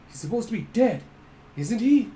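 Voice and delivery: rising mock panic; alarmed. Embodies doubting a dead man returned, alarmed. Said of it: "So Embodies like".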